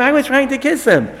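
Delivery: high, eager protesting voice; fake innocent denial. Esav insisting to the audience he loves Yaakov, high and eager. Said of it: "Esav, "I wasn't trying to bite him".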